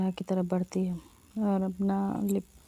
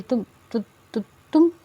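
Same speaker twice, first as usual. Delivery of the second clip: stammering, jumping higher and louder; startled stammer; 3 semitones higher, 7 dB louder, faster than usual. Acted. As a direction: trip over the first word, caught off guard. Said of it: "हकला की तरह बोल रही थी".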